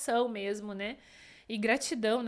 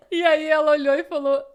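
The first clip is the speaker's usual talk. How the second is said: loud tearful laughing words; laughing through tears; 4 semitones higher, 12 dB louder than usual. Real, not acted. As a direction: talk while laughing and crying. Telling the reveal, emotional.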